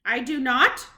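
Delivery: a loud, hard witch shout. Acted. The witch yells back at the grandmother. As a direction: bark it back at her. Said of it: "shouted the Grand High Witch".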